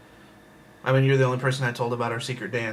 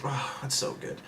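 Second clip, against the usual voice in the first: breathy whisper; 11 dB quieter than usual. Real, not acted. Gushing about secret name.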